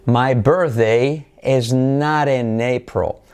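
said at half speed, each word separate; slow model sentence. Shown as a demonstration. Teacher reading the reference sentence slowly for learners. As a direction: read it slowly for copying. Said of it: "La frase de referencia es".

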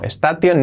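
"station" as es-ta-TION; hard t in "station", read as spelled; wrong Spanish reading. Shown as a demonstration. Teacher rejects this version: "station" as es-ta-tion. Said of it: "station no".

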